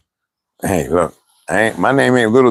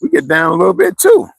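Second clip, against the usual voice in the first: family teasing; 8 semitones higher than usual. Retold or imitated. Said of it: "they was like".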